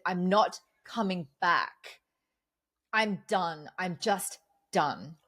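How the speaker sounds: slow, final, each word set down; dead-set, slowly spelling it out; firm refusal. Retold or imitated.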